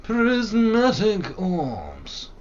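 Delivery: slow high drawn-out announcer chant; slow mock-epic chant; dramatic intonation. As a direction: savour each word like a grand incantation.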